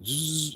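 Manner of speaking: short vocal buzz; imitated buzzing. Shown as a demonstration.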